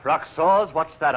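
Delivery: high, loud startled echo; loud, high alarm at the name. Acted. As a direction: caught off guard, blurting it. Man caught out by the name.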